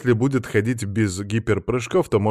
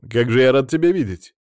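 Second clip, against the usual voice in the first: loud, higher show of delight; exclaiming; 4 semitones higher, 8 dB louder than usual. A narrator's reading. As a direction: gush at seeing an old friend. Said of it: "воскликнул я". (радостное восклицание)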